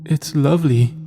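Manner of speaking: sniveling. A narrator's reading. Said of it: "she sniveled".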